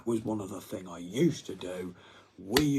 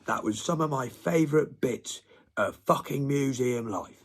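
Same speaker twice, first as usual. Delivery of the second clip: normal talking voice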